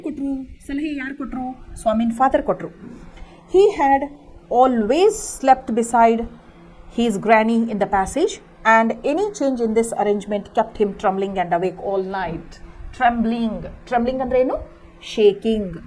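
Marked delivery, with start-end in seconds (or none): 3.5-12.5 slow, louder classroom reading; deliberate storybook reading for a class; story read-aloud. A narrator's reading. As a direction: read each word out slowly for pupils. Teacher reads story text aloud.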